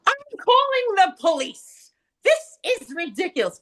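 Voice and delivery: high, slow indignant announcement; mock outraged client. Client threatening to call the police, outraged. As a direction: squeal it out like an affronted customer. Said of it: "stands up and goes".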